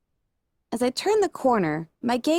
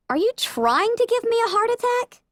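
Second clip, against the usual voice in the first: much higher, startled and indignant; jumpy, shrill complaint after a scare; startled outburst; 13 semitones higher than usual. Acted. Startled protagonist snapping at bot.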